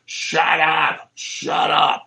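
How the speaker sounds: high, strained shout without real volume; mock scream. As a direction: imitate screaming, held back and breath-heavy. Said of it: "starts screaming".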